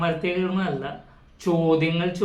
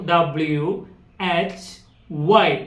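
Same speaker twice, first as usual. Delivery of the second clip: slow spelling; 4 dB louder, much slower than usual. Shown as a demonstration. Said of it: "വ് എന്നാണ് ഉച്ചരിക്കുക".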